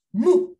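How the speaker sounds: a short, forceful high "Mu"; stronger stressed syllable. Shown as a demonstration. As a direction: hit "Mu" hard as the stressed syllable. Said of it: "Ahí suena más fuerte".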